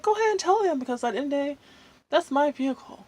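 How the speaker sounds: high-pitched, defiant retelling; retold defiance. Retelling defiant reply to ex's mother.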